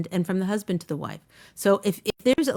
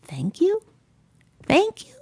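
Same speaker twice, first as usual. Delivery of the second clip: rising, second much higher; incredulous thank you; 8 semitones higher, slower than usual. Retold or imitated. Wife indignant at thanking husband for a dish, disbelieving. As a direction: question the very idea of thanking him. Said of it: "I'm supposed to say".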